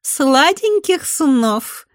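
high, very slow, coy; sassy drag queen. A narrator's reading. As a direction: sing it out sweetly and slow. Ада's coy farewell.

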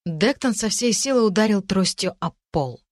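plain reading voice

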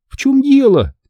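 very high startled question; exclaiming. A narrator's reading. Girl to boy as lamps go out, exclaiming. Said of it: "воскликнула Эмили".

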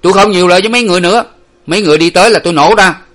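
loud, high, rapid threat; angry sentry browbeating; bullying shout. A narrator's reading. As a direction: bark a final warning at them. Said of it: "nạt nộ".

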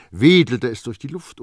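usual speaking voice

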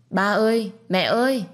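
put-on child calling loudly for his parents. Small boy calls out for his parents, loudly. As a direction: call out for mom and dad.

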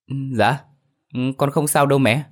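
put-on quick, unbothered young man's reply. A narrator's reading. Son tells mother he is fine, young man's voice. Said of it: "giọng một thanh niên vang lên".

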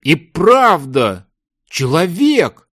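slow, subdued amazement; stunned realization. A narrator's reading. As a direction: freeze and confirm it in disbelief. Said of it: "мужчина вдруг застыл". (ошеломлённо, медленно)

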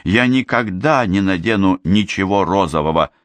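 plain reading voice

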